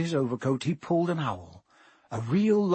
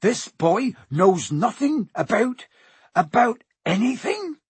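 loud, high, slow accusation; outraged growl; 7 semitones higher, 5 dB louder, slower than usual. A narrator's reading. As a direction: drag out each phrase in mounting outrage. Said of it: "he growled at the Dursleys".

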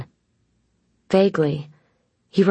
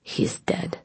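bare whisper of grief; 4 dB quieter than usual. A narrator's reading. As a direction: breathe it out, no voice. Drunk man mentions dead brother.

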